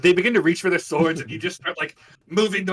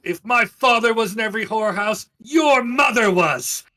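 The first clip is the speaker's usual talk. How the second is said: loud, higher insult; a noble hurling an insult; insulting shout; 7 semitones higher, 7 dB louder, slower than usual. Acted. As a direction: shout the jab at him.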